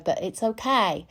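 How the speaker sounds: ordinary delivery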